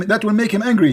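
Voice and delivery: ordinary talk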